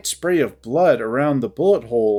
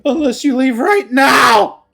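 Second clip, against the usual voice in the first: high screaming threat, voice cracking; frantic scream, much higher and louder; 10 semitones higher, 7 dB louder, slower than usual. Acted. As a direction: scream it with rising desperation. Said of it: "She's absolutely screaming".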